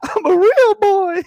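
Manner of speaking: put-on high, loud, drawn-out boy squeal; mock little boy voice. Host ribbing a man's high-pitched voice, in a mock little boy voice. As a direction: squeal it slowly like a cartoon boy.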